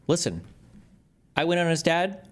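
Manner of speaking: normal talking voice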